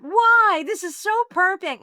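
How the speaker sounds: high, loud why then whining it's perfect; surprised protest. Retold or imitated. To Mark about leaving the unit, protesting high. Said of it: "I went".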